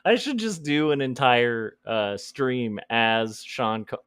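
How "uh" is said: usual speaking voice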